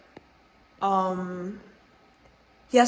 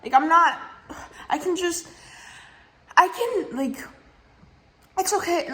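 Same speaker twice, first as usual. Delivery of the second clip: much higher, strained, fed-up voice; retold exasperated outburst; 8 semitones higher than usual. Refusing to deal with the rude couple, high exasperated retold voice. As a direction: throw up your hands, retold. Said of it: "I was like".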